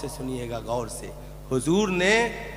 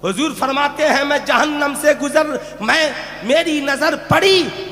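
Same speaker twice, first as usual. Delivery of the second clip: high, raised narration from the pulpit; declamatory preaching; 12 semitones higher, 7 dB louder than usual. Real, not acted. Preacher relating the Prophet's sight of hell, high and declamatory. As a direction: proclaim the sacred account, voice lifted.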